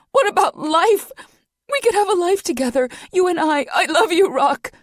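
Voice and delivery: sobbing out her love; crying. Acted. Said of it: "now crying in earnest".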